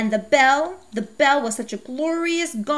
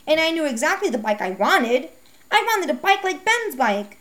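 ordinary delivery

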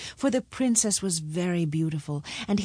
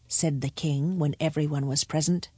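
ordinary delivery, nothing marked

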